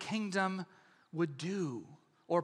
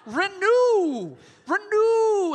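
renew, renew called out high and louder; emphatic renew; 10 semitones higher, 6 dB louder, much slower than usual. Acted. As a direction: hammer the word renew. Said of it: "He says".

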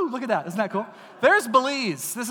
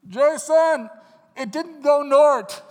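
urgent, louder and higher; alarmed; 6 semitones higher, 7 dB louder, slower than usual. Retold or imitated. Preacher retelling the hurricane news to his friend, urgent and loud. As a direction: blurt out the bad news.

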